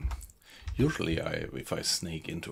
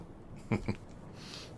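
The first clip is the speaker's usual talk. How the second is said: quiet laugh; 4 semitones higher, 8 dB quieter than usual. Real, not acted. Laughs about own accent.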